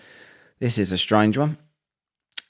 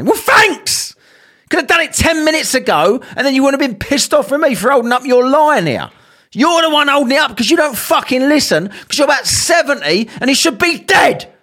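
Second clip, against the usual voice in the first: bitter sarcastic rant; sarcastic thanks; 8 semitones higher than usual. Retold or imitated. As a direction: sneer at the cashier.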